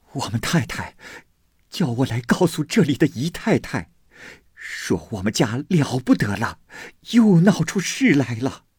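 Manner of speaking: panicked maidservant blurting out trouble; flustered old servant. A narrator's reading. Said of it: "慌慌张张地走来". (慌慌张张的老婆子，声音偏高)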